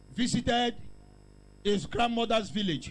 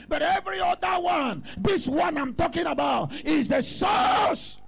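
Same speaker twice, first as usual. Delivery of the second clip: preaching at full force; 5 semitones higher than usual. Acted. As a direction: build each line like a rising altar call.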